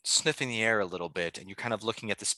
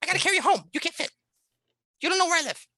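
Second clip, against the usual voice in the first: very high, exasperated mouse voice; manic little mouse; 5 dB louder, much faster than usual. Acted. Mouse explains itself to a confused player, manic. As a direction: squeak it loudly and impatiently. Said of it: "almost manic voice, just really energetic".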